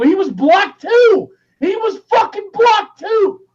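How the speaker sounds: high, loud, slow rising anger; real fury building over the blocking; getting mad. Real, not acted. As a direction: let the anger climb on each line. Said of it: "Oh, I'm getting fucking mad".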